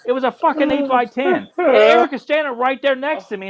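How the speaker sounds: high-pitched incredulous protest; exasperated rant. Real, not acted. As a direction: argue it high, in sheer disbelief. Exasperated rant about the small photo.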